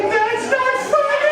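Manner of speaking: put-on high, slow, sing-song child's whine; whiny scared kid. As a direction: wail it like an upset little boy. Scared little Marky protests.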